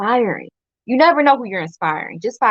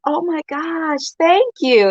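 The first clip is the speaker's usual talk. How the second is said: higher, slow and delighted; delighted surprise; 7 semitones higher, slower than usual. Retold or imitated. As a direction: play the flattered stranger, lightly. Birthday woman thanks her.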